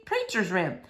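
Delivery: ordinary delivery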